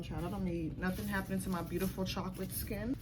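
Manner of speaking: usual speaking voice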